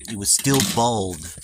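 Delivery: put-on solemn storybook narration, American accent; storyteller voice. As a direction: spin an ancient myth, American accent. Mock-solemn creation tale.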